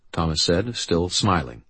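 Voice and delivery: plain reading voice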